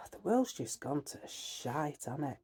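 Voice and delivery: slow, sighing whisper; weary whisper. Real, not acted. Vlogger despairing to viewers, slow weary whisper. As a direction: sigh it out, worn down.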